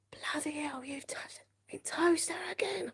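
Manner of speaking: high hushed whispered imitation of mum's fury; mock angry mum. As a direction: hiss it like a cross mum.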